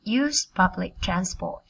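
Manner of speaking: words linked into one continuous phrase. Shown as a demonstration. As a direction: run the phrase together without gaps. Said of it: "Các bạn đọc nhanh hơn cùng cô nào".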